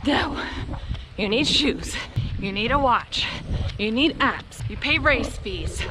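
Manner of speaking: usual speaking voice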